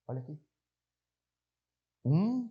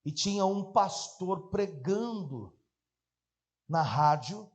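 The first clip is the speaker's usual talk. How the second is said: usual speaking voice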